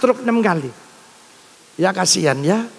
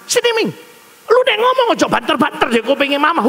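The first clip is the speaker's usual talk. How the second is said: mother's voice; 6 semitones higher than usual. Retold or imitated.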